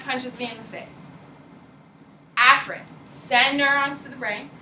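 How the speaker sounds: ordinary delivery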